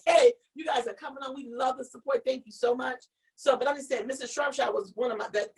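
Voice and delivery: usual speaking voice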